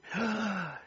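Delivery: sharp dismayed gasp; a hammed-up gasp of dismay; mock gasp. Onlookers gasping at Paul's lost freedom. Gasp inside acted lament.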